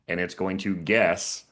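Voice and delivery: slow, with the word guess leaned on hard; stress on guess. Shown as a demonstration. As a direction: say it slowly and hit guess. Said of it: "I'm emphasizing that word guess".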